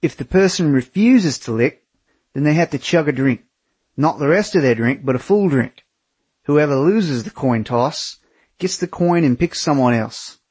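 broad Australian bloke laying down rules; Australian accent. Acted. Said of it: "He continued".

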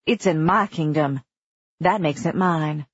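plain reading voice

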